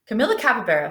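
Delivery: usual speaking voice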